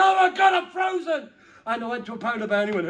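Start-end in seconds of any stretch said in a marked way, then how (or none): 0.0-1.3 high, loud shouted penguin; freezing penguin. Acted. Comedian voicing the freezing penguin, shouting high. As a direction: yell it like a frozen penguin.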